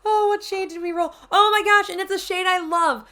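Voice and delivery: thrilled squeal; excited. Real, not acted. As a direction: gush over the shade. Revealing a loved shade.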